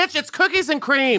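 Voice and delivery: mock outrage; exasperated retort. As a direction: bluntly correct the clueless. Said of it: "And I'm like".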